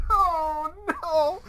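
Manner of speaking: high, laughing dismay. Real, not acted. Player laughing at the dice roll, squealing oh no.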